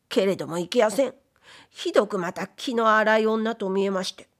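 ordinary delivery

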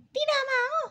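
very high, insistent cat voice; stubborn cat. Pretend. Cat refuses Mami's scolding, high stubborn cat voice. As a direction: play a sulky little cat refusing.

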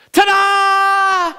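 put-on high, drawn-out child's fanfare; triumphant little girl. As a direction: announce it proudly like a little girl. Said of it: "a little girl waved her hand".

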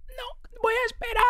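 high, slow, strained voiceless croak; croaky whisper. Retold or imitated. Telling the waiter he'll wait, in a voiceless croak. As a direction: squeeze the words out with no voice left. Mimics hoarse lost voice to waiter.

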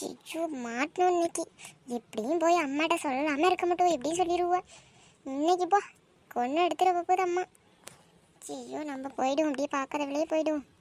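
soft, fearful undertone; anxious whisper-quiet. Acted. Girl dreading her mother finding out, muttering softly. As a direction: creep away muttering in dread.

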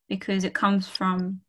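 ordinary talk

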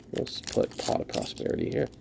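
fast, soft whispered aside. Real, not acted. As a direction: whispers to himself while sorting. Muttered aside while sorting.

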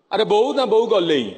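imitating a Jatni villager's Odia; Jatni dialect. Describing Jatni folk, slips into their dialect. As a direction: put on the local Jatni speech. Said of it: "ଜଟଣୀ ଲୋକ ଥିଲେ".

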